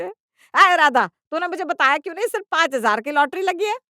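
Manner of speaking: loud, high outraged accusation; acted mother-in-law blowing up; furious shout. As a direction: yell at her, drawing out the name. Mother-in-law furiously confronts daughter-in-law.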